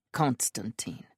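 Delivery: low, soft and grim; grim low finish of her sentence; grimly. A narrator's reading. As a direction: drop the name like a verdict. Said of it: "Gavriel finishes grimly".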